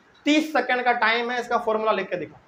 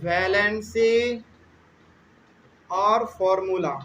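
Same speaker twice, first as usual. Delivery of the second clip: slow listing of steps; much slower than usual. Shown as a demonstration. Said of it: "teacher listing the steps slowly".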